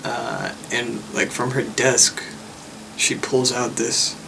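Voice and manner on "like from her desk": normal talking voice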